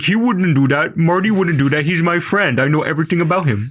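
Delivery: high, whiny fanboy insisting; mocking defensive fans. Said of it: "And they're like".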